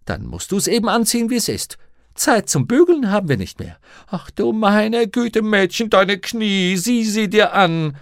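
high, loud scolding; exasperated housekeeper. A narrator's reading. Mrs Lonsdale appalled at the girl's knees, exasperated scolding. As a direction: fuss shrilly, drawing out the dismay.

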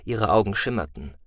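ordinary delivery, nothing marked

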